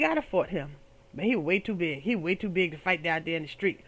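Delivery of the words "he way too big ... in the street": normal talking voice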